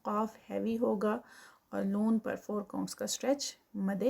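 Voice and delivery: usual speaking voice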